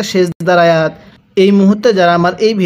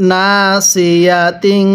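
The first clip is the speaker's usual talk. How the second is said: slow recitation; much slower than usual. Shown as a demonstration. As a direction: stretch নাসিয়াতিন slowly syllable by syllable. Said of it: "হ্যাঁ পড়ুন". (ধীরে টেনে নাসিয়াতিন)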